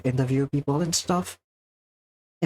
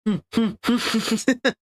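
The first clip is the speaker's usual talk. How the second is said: high giggling laugh; 9 semitones higher than usual. Real, not acted. Streamer cracks up at a chat message, giggling. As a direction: burst of quick, high giggles.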